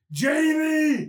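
stretched-out acted call of a name. Arnold talking over the parade speakers to Jamie, calling out. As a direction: draw the name out like a hail.

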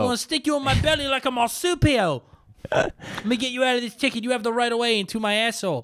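high, wandering mock Aussie accent; Australian lady cop. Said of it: "I don't know why I changed my accent".

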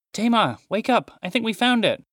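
high, loud excited call. Acted. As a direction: wake her with thrilling news. Wakes sister with discovery.

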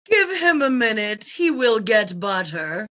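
a hint of an elderly wife, slowing; lightly voiced widow. Widow telling the guests, lightly voiced old lady. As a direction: voice the old wife, slightly dropping low. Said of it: "saying to the assembled guests".

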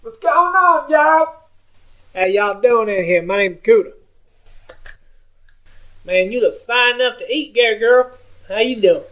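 put-on high, twangy good old boy greeting the room. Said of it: "he is a good old boy redneck".

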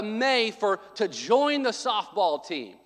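normal talking voice

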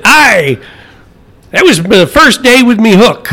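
pirate voice. Acted. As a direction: belt it like a pirate. Pirate joke, pirate voice.

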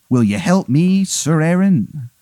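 pleading, hammed-up English accent; Henry the eighth, bad English accent. King asks Aaron for help, in a bad English accent. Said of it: "it's a very bad English accent".

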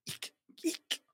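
tiny whispered squeaks; whispered eek. Acted. Dad reading to a child, whispered eeks. Final sound list.

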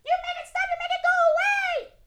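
put-on high, whiny little boy yelling; child shrieking. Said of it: "it's Jude being like".

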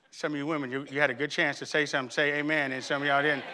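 usual speaking voice

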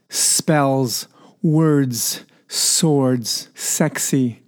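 long hissing S in "Spells, words, swords, sexy"; hissed S. Shown as a demonstration. Host showing the hiss of English, S sounds hissed and emphasized. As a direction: lean hard on every S, drawing it into a hiss. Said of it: "the hissing and the emphasis on the S".

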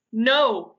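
loud, drawn-out refusal; bellowing. A narrator's reading. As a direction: bellow it in protest. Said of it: "he bellowed".

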